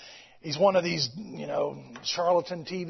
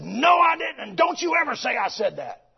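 retold angry retort; 6 semitones higher, 3 dB louder than usual. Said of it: "he got right in her face".

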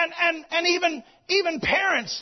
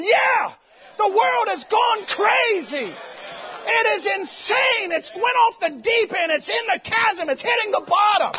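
on your feet, shouting agreement; fervent agreement shout; 6 semitones higher, slower than usual. Retold or imitated. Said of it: "I was on my feet going".

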